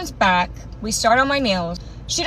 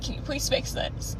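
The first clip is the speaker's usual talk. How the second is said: hushed, voiceless acted plea; mock-tearful whisper, begging for help; 11 dB quieter than usual. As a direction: beg in a small, whispered, weepy voice. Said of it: "with tears just streaming down my face".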